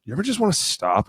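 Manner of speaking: imitating his kid's halting question; child asking dad. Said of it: "a lot of my kids are like".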